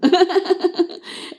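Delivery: real high-pitched giggle; high laugh. Real, not acted. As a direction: laugh it off while fighting back tears. Laughs while fighting tears.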